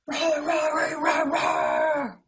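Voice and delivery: very loud, high roaring; monster roar. Acted. Leo the monster roaring out of the box.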